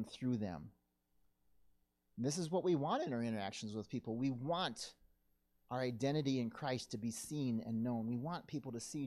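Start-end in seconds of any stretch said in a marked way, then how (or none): none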